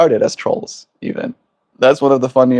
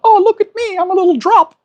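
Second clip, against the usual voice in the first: much higher, playful voice of a tiny water drop; little drop voice; faster than usual. Retold or imitated. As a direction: pitch it way up like a cheerful little droplet.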